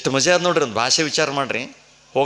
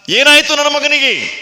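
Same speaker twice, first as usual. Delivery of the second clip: high, loud panicked question; alarmed shout; 10 semitones higher, 6 dB louder than usual. Acted. King shouting at the boys about his son. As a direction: demand an answer in sudden fear.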